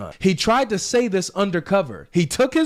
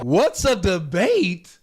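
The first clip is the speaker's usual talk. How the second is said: mock confused preacher; much slower than usual. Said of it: "That's a pretty good Sheikh Uthman impression".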